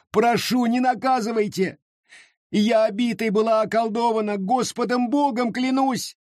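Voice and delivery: put-on high, desperate begging girl; frightened pleading. A narrator's reading. Mary begs the elders, high and frightened. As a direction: swear it through terror.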